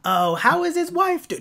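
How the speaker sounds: put-on high voice, asking after someone; mock phone character. Asking old friend about his wife, mock high voice.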